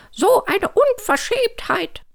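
high, drawn-out indignant squawk; cackling hen. A narrator's reading. Hen scolds Hugo for his cheek, indignant cackle. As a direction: cluck it slowly in outrage. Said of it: "gackerte Hanni".

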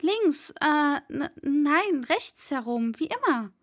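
startled servant catching his own mistake; flustered stammer. Acted. Servant answering the master's odd question, stammering and flustered.

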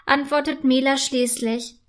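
usual speaking voice

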